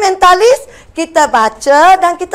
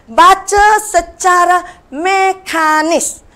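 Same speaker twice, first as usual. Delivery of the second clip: slow, stressed term; 3 semitones higher, slower than usual. Shown as a demonstration. Teacher stressing a new term.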